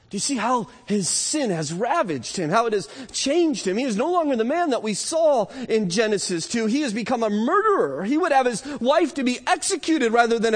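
high-pitched, urgent pulpit delivery; impassioned preaching. Real, not acted. Preacher decrying Adam's betrayal of his wife, impassioned and high. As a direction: preach it with rising indignation.

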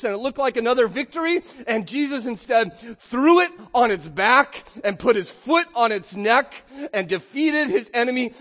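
loud, high, ringing pulpit cry; preaching at full force. Acted. Preacher exulting to the congregation that death has lost, full-force preaching. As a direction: shout the victory over death.

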